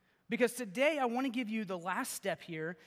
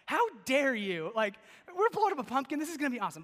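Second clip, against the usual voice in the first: high, hammed-up indignation; a retold teenage huff at the leaders; mock outrage; 6 semitones higher, 5 dB louder than usual. Teen boy protesting church leaders blocking the pumpkin bomb, mock outrage. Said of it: "I literally was so mad".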